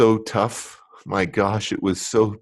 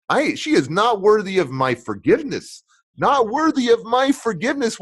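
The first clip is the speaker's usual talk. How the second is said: rising, higher indignant protest; indignant refusal; 8 semitones higher than usual. Retold or imitated. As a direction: refuse to forgive her, heated. Arguing with the inner voice.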